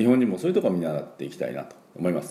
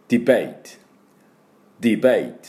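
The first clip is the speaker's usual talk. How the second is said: ディベート with the accent on ベー, louder; ディベート said with ベイ stressed, short crisp ディ; stress on bay; 4 dB louder, faster than usual. Shown as a demonstration. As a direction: punch the ベー in ディベート. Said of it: "ベイのところにアクセントがあります".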